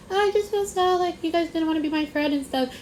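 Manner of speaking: high, whiny imitation of a tearful friend; mock crying. Retelling a friend's upset phone call, mock crying. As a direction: pitch it way up, pretend to cry. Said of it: "she's, like, crying".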